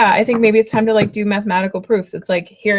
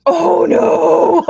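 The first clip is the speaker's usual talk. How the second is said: higher, louder oh no; dismayed cry; 7 semitones higher, 6 dB louder than usual. Real, not acted. Reader crying out at the next card. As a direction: yelp at the card.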